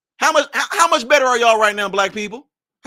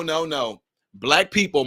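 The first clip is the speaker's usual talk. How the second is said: normal talking voice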